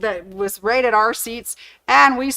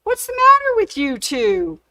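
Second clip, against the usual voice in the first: friend's voice, pitched much higher, a bit louder; puzzled friend; 11 semitones higher, 4 dB louder than usual. Retold or imitated. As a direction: play the baffled friend who can't believe their grins. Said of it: "she looks at us and she goes".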